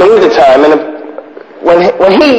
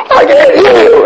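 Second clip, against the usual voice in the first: much higher, louder laughing yell; mock drill yell; 3 dB louder than usual. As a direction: bellow it with a laugh breaking through. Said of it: "And you go".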